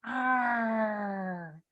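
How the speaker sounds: long drawn-out "arrr" growl. Shown as a demonstration. Speech coach warming up tongue and soft palate, growling. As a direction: hold a growled R, sliding gently down. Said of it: "soft palate by growling".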